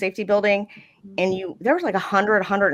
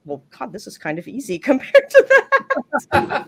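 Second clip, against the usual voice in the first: raised pitch, breaking into a laugh; amused disbelief; 6 semitones higher, 3 dB louder than usual. Retold or imitated. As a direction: retell your relief, laughing by the end. Retold reaction, breaking into laughter.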